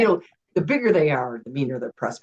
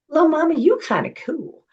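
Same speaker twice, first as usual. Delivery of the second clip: high, laid-back Snoop voice, a bit louder; imitating Snoop, pitched way up and easy; [name] impression; 12 semitones higher, 4 dB louder than usual. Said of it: "he goes".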